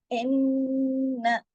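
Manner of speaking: इन्ना with a long nasal ghunna on the double न; slow tajweed recitation. Shown as a demonstration. Teacher showing the ghunna to students, slow. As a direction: hum the doubled न in इन्ना slowly. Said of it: "गुन्ना करना है".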